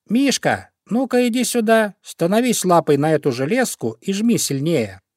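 high, brisk instructions to a helper; urgent commanding. Acted. Pufik gets bear to free hare, commanding. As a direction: call him over and direct him.

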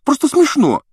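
very high, loud indignant outburst; exasperated exclamation. A narrator's reading. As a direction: cry out, fed up with the nonsense. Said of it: "воскликнула Гермиона". (возмущённо, громко)

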